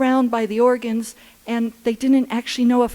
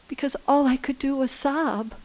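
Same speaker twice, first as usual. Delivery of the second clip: normal talking voice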